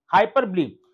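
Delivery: "हाइपरबोलिक" said as hai-par-blee, no final k; correct pronunciation. Shown as a demonstration. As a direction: end "हाइपरबोलिक" on a long lee, not bowl. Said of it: "इसको हाइपरबोल नहीं पढ़ना". ("हाइपरबोलिक" का उच्चारण हाइपरब्ली, अंत में ली)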